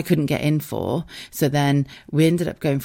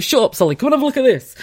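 louder, higher retold banter; mock calling out; 10 semitones higher, 5 dB louder, faster than usual. Lads answering her teasing, loud imitated banter. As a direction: call over a mate in mock protest.

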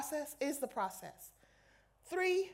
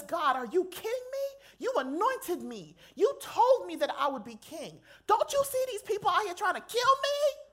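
desperate prayer; 6 semitones higher, 4 dB louder than usual. Retold or imitated. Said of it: "And he says".

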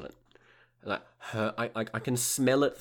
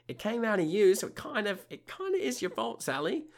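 exasperated; 11 semitones higher than usual. Retold or imitated. Exasperated reply to Sally.

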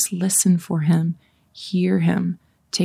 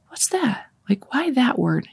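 high, light puzzled questioning; puzzled wondering; 6 semitones higher than usual. Acted. Speaker voices a listener noticing a word, puzzled wondering. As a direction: wonder aloud in a high, softened voice. Acts out puzzled inner reaction.

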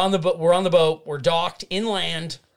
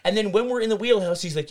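ordinary talk, nothing marked

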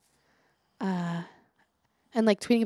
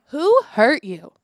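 announcing the title; 4 semitones higher, 10 dB louder, slower than usual. Shown as a demonstration.

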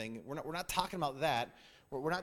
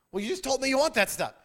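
higher, louder, exasperated protest; husband throwing up his hands, louder; husband baffled by his wife, pitched up and louder; 9 semitones higher, 9 dB louder than usual. Retold or imitated.

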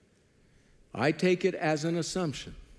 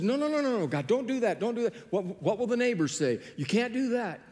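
high, pitched-up mock pleading; pleading Moses; 7 semitones higher than usual. Preacher voicing Moses pleading with God, mock begging. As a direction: beg God to stop, voice lifted high.

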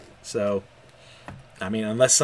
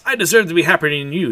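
bitter outburst; 5 semitones higher, 7 dB louder than usual. Acted. Dominic's bitter cry.

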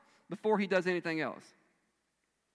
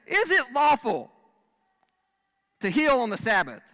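preacher booming Jesus's challenge; challenging; 7 semitones higher, 9 dB louder than usual. Retold or imitated. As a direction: throw down the question to the crowd. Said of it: "he throws down".

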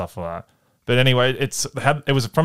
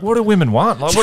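laughing mock whine; 10 semitones higher, 5 dB louder than usual. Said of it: "He's like".

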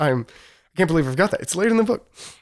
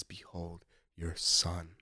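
hushed quote; 5 semitones lower, 13 dB quieter, slower than usual. Retold or imitated. Christ speaking from the cross.

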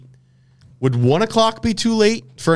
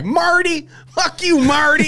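mock Missouri twang; slower than usual. Host impersonates Ozark wife yelling at Marty, high twangy mock yell. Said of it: "She's got that Missouri, uh, twang going on".

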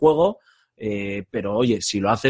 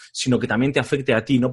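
usual speaking voice

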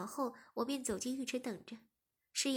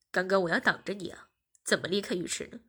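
deeper voiced, a bit defensive; lower boyfriend explaining; 5 semitones lower, 5 dB louder than usual. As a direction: justify yourself. (低沉解释的男声)

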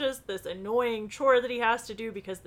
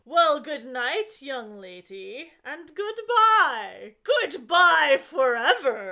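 sinister farewell; 4 semitones higher, 6 dB louder, slower than usual. Acted. Villain's farewell taunt read aloud.

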